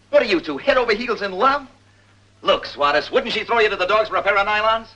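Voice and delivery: high, loud grilling; angry interrogation. Acted. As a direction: hammer the suspect with questions. Cop grills suspect, pressing hard.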